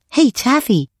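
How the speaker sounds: put-on high-pitched excited child calling his dog; exclaiming. A narrator's reading. PJ to Taffy in his dream, exclaiming. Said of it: "he exclaimed".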